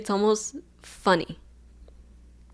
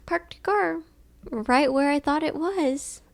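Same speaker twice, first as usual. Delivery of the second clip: robotic app voice; 7 semitones higher, slower than usual. Retold or imitated. Reading out the phone app.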